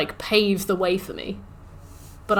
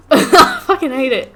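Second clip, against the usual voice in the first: laughing frustration; 4 semitones higher, 7 dB louder than usual. Real, not acted. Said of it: "frustrated rant".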